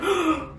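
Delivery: high, sudden intake of breath; imitated shocked gasp. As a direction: gasp like an overwhelmed friend.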